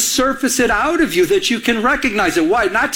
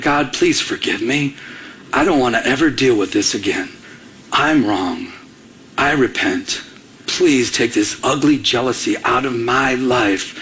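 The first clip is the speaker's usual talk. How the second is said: repentant prayer; 7 semitones lower than usual. Retold or imitated. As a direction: pray it earnestly, pitched well down.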